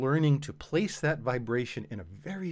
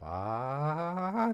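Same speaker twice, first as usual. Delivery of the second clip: rising sung ah up to the break; voice cracking up; 5 semitones higher than usual. Shown as a demonstration. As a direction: slide up until the voice cracks. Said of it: "there's a point at which your voice is gonna crack".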